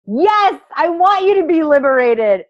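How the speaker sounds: high, loud rallying cry; excited cheer. Real, not acted. Coach cheering the women on toward liberation, bright and loud. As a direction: lift them up with it. Enthusing to her group.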